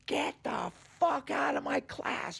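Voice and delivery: high, slow, strained mock yell; yelling professor. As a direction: imitate a furious teacher throwing a student out. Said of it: "he's yelling at me".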